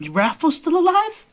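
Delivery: high, loud surprised question; astonished. Acted. Girl learning friend's brother is alive, astonished. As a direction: blurt it out in disbelief.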